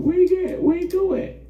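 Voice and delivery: high mock search for the missing boy; high searching call. Soldiers looking for David, calling high. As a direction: call out as if hunting for him.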